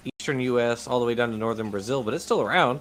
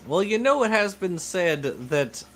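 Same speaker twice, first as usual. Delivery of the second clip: sidestep pompously like a senator at a debate; evasive senator. Acted. Senator dodging a question on taxes, mock evasive politician.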